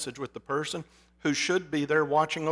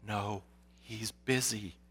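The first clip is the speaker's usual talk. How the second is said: slow, soft little secretive voice; whispering child; 6 semitones lower, 8 dB quieter, slower than usual. A narrator's reading. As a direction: half-whisper it slowly, like a small child. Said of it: "whispered the child".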